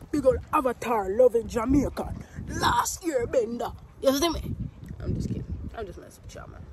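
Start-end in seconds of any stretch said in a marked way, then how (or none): 0.1-4.5 playful, lightly put-on Jamaican accent, louder at the end; hammed-up Jamaican. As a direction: brag like a proud Jamaican, half joking. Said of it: "Specifically a Jamaican friend like me".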